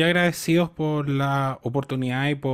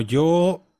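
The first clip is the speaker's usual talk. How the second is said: normal talking voice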